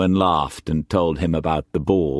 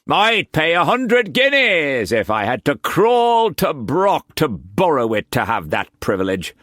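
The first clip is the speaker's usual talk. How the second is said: gloating Quantz; 9 semitones higher than usual. A narrator's reading. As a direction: crow about yourself. Quantz eagerly vowing.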